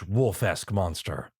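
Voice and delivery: ordinary delivery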